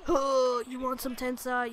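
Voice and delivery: ordinary delivery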